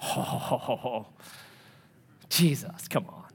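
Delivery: low, hushed scoff; dismissive Peter. Acted. Peter answering Jesus's warning that all will flee, dismissive. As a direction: brush it off softly, almost whispered. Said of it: "what does Peter say".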